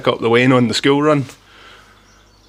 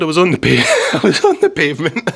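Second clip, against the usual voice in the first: high-pitched, breaking up with laughter; laughing protest; 5 semitones higher than usual. Real, not acted. Rider protesting the policewoman's mistake, really laughing. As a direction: crack up mid-word and start over.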